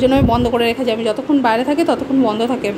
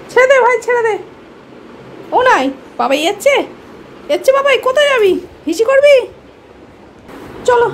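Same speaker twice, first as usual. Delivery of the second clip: pet talk; 10 semitones higher than usual. Real, not acted. Asking the pet where it is going, high baby talk. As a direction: chatter up high to a little pet.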